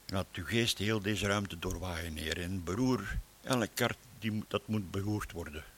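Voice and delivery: soft, hushed prayer voice; quiet reverent opening prayer. Acted. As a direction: pray quietly and humbly to God. Opening prayer before sermon.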